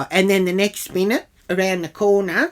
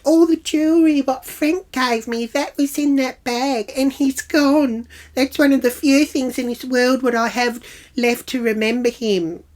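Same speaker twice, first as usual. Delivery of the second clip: high, louder imitated wail; hammed-up tearful appeal; mock teary; 8 semitones higher, 4 dB louder than usual. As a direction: wail it mockingly like a bereaved woman pleading. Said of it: "She starts getting all teary".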